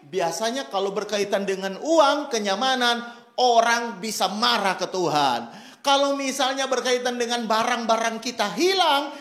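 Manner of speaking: ordinary delivery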